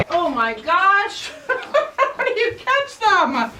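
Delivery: slow, high words through laughter; can barely talk for laughing. Real, not acted. Piglets scramble off the scale, she laughs through words. Piglets escaping while weighing.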